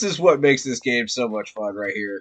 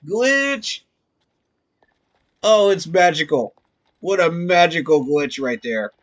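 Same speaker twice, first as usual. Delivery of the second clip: loud, high, thrilled exclamation; dawning joy; 3 semitones higher, 5 dB louder than usual. Real, not acted. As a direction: shout it out, thrilled by the discovery. Delight at a glitch.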